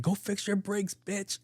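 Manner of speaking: high-pitched, softened mock yell; mock angry heckler. A stranger heckling the sister as she drives off, mock high yell. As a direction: imitate an irate driver in a high, held-back voice.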